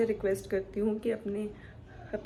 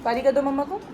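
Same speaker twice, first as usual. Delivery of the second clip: higher, louder cooing repeat; 4 semitones higher, 8 dB louder, faster than usual. Real, not acted. Mother asking her baby again for a kiss, higher cooing. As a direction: ask again, sweeter and higher, lifting on कर.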